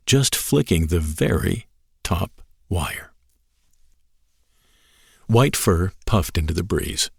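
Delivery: ordinary delivery, nothing marked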